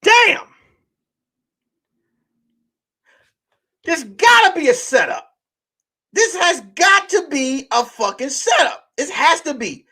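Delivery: high, incredulous, half-laughing; can't believe what he's reading; stunned disbelief. Retold or imitated. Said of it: "I was like".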